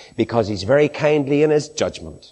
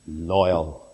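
ordinary talk, nothing marked

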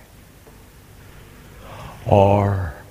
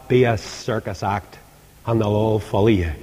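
ordinary talk

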